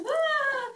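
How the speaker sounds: high mock scream of frightened kids. Narrator imitating the kids' high frightened scream. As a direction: shriek like the scared children on the tram. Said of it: "and the kids are all".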